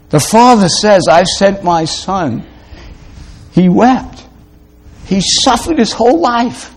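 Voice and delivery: high-pitched, pleading sermon climax; impassioned preaching. Real, not acted. Preacher telling listeners Christ suffered for them, impassioned.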